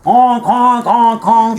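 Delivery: loud high silly honks; silly goose honk. Pretend. Imitating a honking bird.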